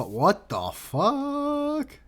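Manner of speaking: slow, high, stretched out; drawn-out what the fuck. Real, not acted. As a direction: drag out the disbelief. Reacting to ugly font.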